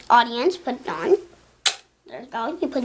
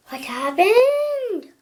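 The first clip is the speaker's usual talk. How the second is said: surprised gasp; 9 semitones higher, 3 dB louder, much slower than usual. Real, not acted. Trick fails unexpectedly.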